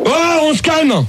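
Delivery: high, drawn-out telling-off; annoyed rebuke. Real, not acted. Host tells a caller to settle down, annoyed rebuke. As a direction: rein them in with a slow, high rebuke.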